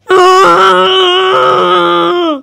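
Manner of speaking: high, loud, desperate shriek; panicked scream. Acted. Mario shrieking in panic because there is no pizza. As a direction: scream it like the world is ending.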